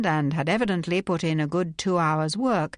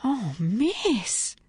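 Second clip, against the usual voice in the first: breathed; 5 semitones higher than usual. Acted. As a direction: breathe it out, shocked. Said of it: "she breathed".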